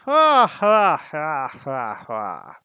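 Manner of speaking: a devastating booming dragon laugh; dragon really laughs. A narrator's reading. Smaug scorns Bilbo's talk of revenge, booming laugh. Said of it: "Then Smaug really did laugh".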